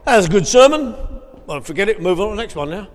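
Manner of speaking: loud, high mock churchgoer; brisk hammed-up brush-off; dismissive churchgoer. Mimicking a congregation dismissing sermon.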